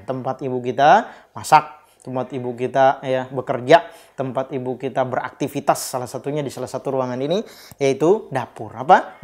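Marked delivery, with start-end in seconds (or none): none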